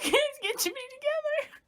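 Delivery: very high mock-crying wail; acted sobbing reaction. Viewer echoing Sam at the grave, acted sobbing. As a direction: wail it as if bursting into tears. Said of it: "could have bursted out in tears".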